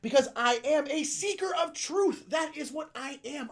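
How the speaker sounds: slow, loud grand declaration; proclaiming. Pretend. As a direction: announce it like a prophet. Proclaiming himself seeker of truth.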